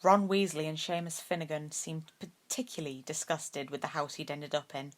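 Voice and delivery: ordinary delivery, nothing marked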